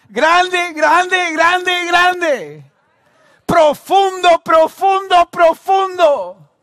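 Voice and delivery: very high, loud chant-like repetition; climactic preacher's shout. Real, not acted. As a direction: hammer each repeated word at full voice.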